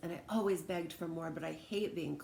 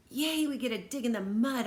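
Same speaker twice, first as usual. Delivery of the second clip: put-on high, loud child's cheer; a kid shouting with glee; excited shout; 6 semitones higher, 7 dB louder than usual. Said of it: "I shouted".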